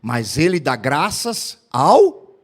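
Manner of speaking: slow, weighty sermon close; preaching conclusion. Acted. Pastor driving home the moral to the congregation, slow and weighty. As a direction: let each word land slowly like a final verdict.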